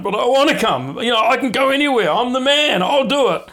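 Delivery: high, loud, fast cocky boast; brash Peter. Acted. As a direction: play Peter puffing himself up.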